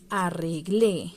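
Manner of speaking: arreglé said slowly with a loud final -GLÉ; a-rre-GLÉ, final é stressed; stress on final syllable. Shown as a demonstration. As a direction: stretch arreglé out, landing on the last syllable. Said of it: "en donde suena el acento".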